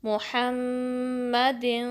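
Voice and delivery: मुहम्मदिन slow, the final नून hidden into the nose; slow recitation; ikhfa nasal. Shown as a demonstration. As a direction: hide the tanween of मुहम्मदिन in the nose. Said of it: "sound को नाक में ले जाएंगे".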